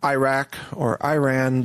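Iraq and Iran as EYE-RACK, EYE-RAN; long eye at the start of Iraq and Iran; American pronunciation. Shown as a demonstration. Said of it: "sometimes I say".

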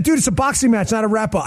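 ordinary talk

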